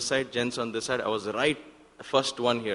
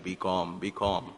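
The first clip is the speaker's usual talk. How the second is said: a hint of a father's low calm voice; lightly voicing his father's calming words; 4 semitones lower, 4 dB quieter, slower than usual. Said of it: "my father's voice from behind".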